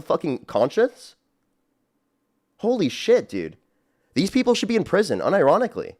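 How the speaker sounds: real disbelief boiling over; outraged. Real, not acted.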